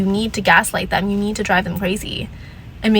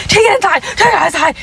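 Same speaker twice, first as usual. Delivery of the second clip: much higher, louder mock Karen; high, loud imitation of an angry neighbour; imitating the Karen; 9 semitones higher, 4 dB louder than usual. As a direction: mimic her shrill order, pitched high and loud. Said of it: "She was like".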